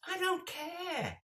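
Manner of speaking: very high, drawn-out mock yell; imitated fed-up wail; exasperated heckler. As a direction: stretch it out in total exasperation.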